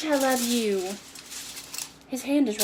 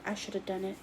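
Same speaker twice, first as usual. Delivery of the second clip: usual speaking voice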